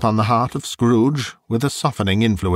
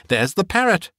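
high, delighted exclamation; excited cry; 8 semitones higher than usual. A narrator's reading. Scrooge spots Crusoe's parrot, crying out excitedly. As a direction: cry out in pitched-up surprise. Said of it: "cried Scrooge".

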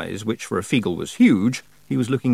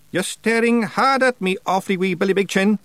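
high, challenging witch voice; stern witch; 12 semitones higher than usual. Acted. Witch confronts small Feegle, stern. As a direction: fix him with a high-pitched challenge. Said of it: "said Miss Treason suspiciously".

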